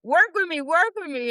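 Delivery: high, pleading to herself; begging her nerves to settle; nervous plea. Retold or imitated. Retelling her nerves at the audition, high mock plea. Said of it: "I'm like".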